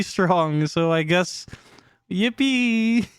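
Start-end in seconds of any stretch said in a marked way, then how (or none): 2.1-3.1 high, drawn-out yippee; playful cheer. Pretend. Streamer capping off gloomy card readings, playful high cheer. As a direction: cheer it high and far from your usual voice.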